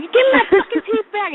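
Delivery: high, exasperated demand at husband; mock screaming. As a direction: re-enact a high, frantic scream. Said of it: "So I'm screaming at him".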